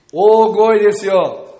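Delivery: loud, drawn-out urging; slow, high, very loud prompt. Shown as a demonstration. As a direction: demand volume by calling out loudly.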